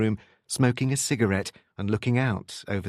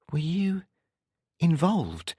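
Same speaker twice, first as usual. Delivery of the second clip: tentative probing; 8 semitones higher, slower than usual. A narrator's reading. Woman cautiously probes his secret past.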